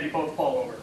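ordinary delivery

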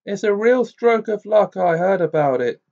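brisk, pressing news; Amos Diggory. A narrator's reading. Diggory's head speaking.

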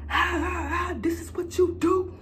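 high, wailing, half-sobbing accusations; mom's crying rant. Retold or imitated. Mother confronting her daughter, high crying rant. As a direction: rant like a mother who just found out. Mom yelling, retold.

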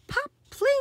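put-on playful explosive pop for kids; popping sound words. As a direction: make the pod burst out loud. Seed pod explodes.

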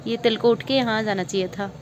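talking very softly. Real, not acted. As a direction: keep it soft and shy, barely above a murmur. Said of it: "मैं बहुत धीरे बात कर रही हूँ ना".

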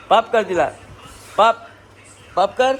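high pretend cat talk; mock cat chatter in a high voice; voicing the cat. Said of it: "काहीतरी तिच्या भाषेत गुंडलते ती".

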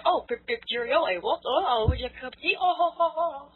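high, hammed-up posh English accent; mock a tea-drinking English gent, high and chirpy. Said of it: "The stereotype for English people is like".

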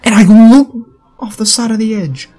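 high, loud, incredulous whine; exasperated disbelief. Real, not acted. Gamer who fell off the edge after jumping, exasperated and high-pitched. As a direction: cry out in high-pitched frustration at an unfair death. Gamer baffled by dying in game.

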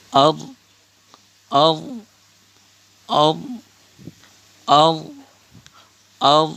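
"ar" sounded firmly on its own; "ar" repeated singly, with pauses; drilled "ar". Shown as a demonstration. Teacher shows alif zabar ra jazm, drilling "ar". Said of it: "উচ্চারণ".

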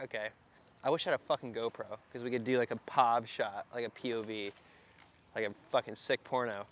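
ordinary talk

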